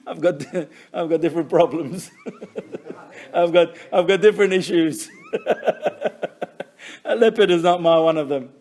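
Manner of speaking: real chuckles breaking through the words; laughing at his own joke. Real, not acted. Rabbi laughing at his own leopard joke, chuckling. Jokes about not knowing leopards.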